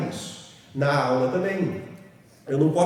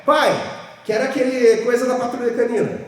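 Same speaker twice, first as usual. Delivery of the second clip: put-on child asking; 6 semitones higher, 4 dB louder than usual. Child asks father in supermarket.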